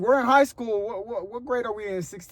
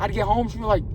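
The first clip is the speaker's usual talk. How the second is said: usual speaking voice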